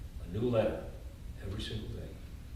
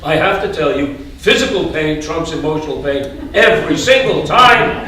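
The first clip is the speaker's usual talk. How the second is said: much louder, higher, frantic; loud and agitated; 5 semitones higher, 11 dB louder than usual. Retold or imitated. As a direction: relive the ant attack loudly. Red ants crawling over him.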